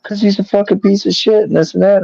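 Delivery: high, put-on voice of an upset ex-wife; retelling his ex-wife's rant about Trump; imitating ex-wife. Ex-wife quoted in argument.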